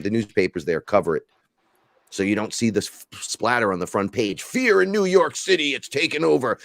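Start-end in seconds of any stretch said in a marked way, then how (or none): 4.4-6.6 high, dramatic newscaster headline; news reporter voice. Retold or imitated. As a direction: read it like a breaking news anchor. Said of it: "That was a great news reporter voice".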